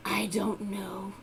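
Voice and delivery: slow, low and feeble; weak voice. Acted. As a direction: sound drained and sickly. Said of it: "in a very weak voice said".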